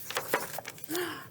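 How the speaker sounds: breathy gasp of delight; soft gasp. Acted. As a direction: gasp at the picture. Teacher gasps at page.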